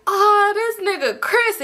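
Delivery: high, slow teasing call; hammed-up drawn-out 'ohh' taunt; mock announcement. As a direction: imitate a friend clowning him, stretched out high. G's brother mocking Chris's entrance.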